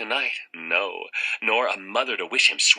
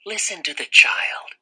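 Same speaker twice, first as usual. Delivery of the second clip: whispering voice; 8 semitones higher, 4 dB louder than usual. Acted. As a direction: sly whisper from the bushes. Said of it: "said a voice in the bushes".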